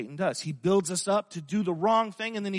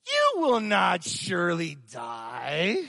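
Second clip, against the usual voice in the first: sly serpent voice; 2 semitones lower, slower than usual. Acted. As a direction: lean into the lie like the serpent. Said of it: "My wife says I do that really good".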